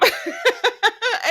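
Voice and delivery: a sudden squealing laugh; high burst of laughter. Real, not acted. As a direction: let the laugh escape high and bright. Laughing at Gabby's line.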